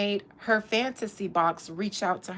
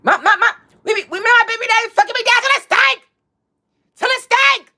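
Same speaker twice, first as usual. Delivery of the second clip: high, loud, fast stammering shout; stuttering yell; 12 semitones higher, 10 dB louder, faster than usual. Retold or imitated. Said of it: "she was fumbling her words and stuttering and yelling".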